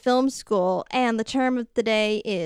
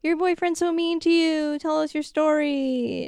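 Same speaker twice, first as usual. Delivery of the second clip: sympathetic women; 6 semitones higher than usual. Retold or imitated. Women consoling crying Bridget in prison, mock-sympathetic. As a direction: imitate pitying women, pitched well up. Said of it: "and they're like".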